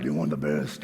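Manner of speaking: very deep, growly shop-guy voice; mock tough salesman. Shop guy challenging the customer, mock tough. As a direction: drop way down into an exaggerated macho rumble. Shop guy's reply, acted very low.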